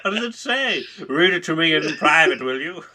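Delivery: nosy horse voice. Pretend. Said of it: "the horse right behind him's like".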